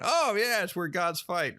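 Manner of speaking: high-pitched mock kid voice; imitating an innocent boy, pitched well up; naive kid. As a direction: chime in like a know-it-all child. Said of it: "He's gonna get made fun of".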